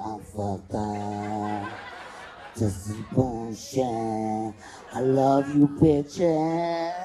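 put-on slow, slurred drunk mumble with a laugh. Drunk friend telling her girls she loves them, slurred and slow. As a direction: drag it out like you're wasted. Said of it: "She's like".

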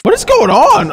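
sudden high startled question. Real, not acted. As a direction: caught off guard, voice jumps up. Startled by scream in game.

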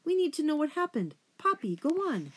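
high, quick, agitated urging; urgent pleading. Acted. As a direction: press a daughter to tell what happened. Upset mother urges her daughter on.

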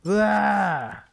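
sharp high cry of effort; startled high ah; short yell. Real, not acted. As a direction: yelp as you dash through. Yell running through angel.

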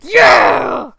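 wild, very loud manic yell; unhinged scream; insane shout. Acted. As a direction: shriek it like a madman. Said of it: "All right, insane".

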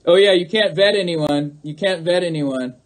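ordinary delivery

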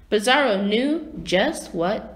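plain reading voice

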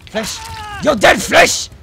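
loud, high taunt; threatening shout. Pretend. Player chasing Flesh, threatening shout. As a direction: shout down your prey.

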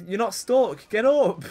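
high, loud, exasperated shouting; urgent yelling. Real, not acted. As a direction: yell at a stuck character to move. Urging stuck game character.

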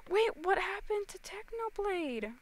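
high, gasping real alarm; sudden high-pitched shock after a gasp. Real, not acted. Streamer reacting to news about Technoblade, alarmed.

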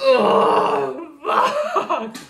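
high yelp, then a laugh; pained shout. Real, not acted. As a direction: cry out from the burn. Eating a very hot pepper.

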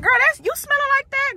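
high, loud nosy friend voice; mock judgy onlooker. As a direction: squeal it like a shocked gossip. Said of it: "People will smell me and be like".

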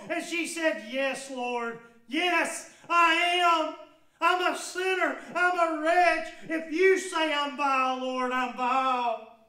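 loud, high, impassioned preaching; preaching at full force. Acted. Preacher pressing the woman's confession on the congregation, impassioned. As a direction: drive each word home to the congregation.

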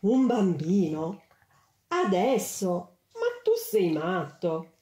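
slow, incredulous businesswoman, each word dragged out; shocked career woman. A narrator's reading. Businesswoman scoffing at the angel, slow and incredulous. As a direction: stretch out the disbelief as if he's mad. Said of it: "gli arruffò tutte le penne".

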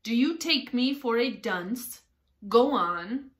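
loud, impatient king; sharp, loud royal retort. A narrator's reading. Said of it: "said the king sharply".

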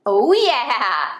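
ordinary delivery

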